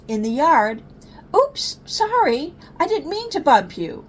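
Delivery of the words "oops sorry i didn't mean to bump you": a small cub saying sorry; high apologetic cub. Acted. Cub bumping sibling in the yard, high apologetic voice.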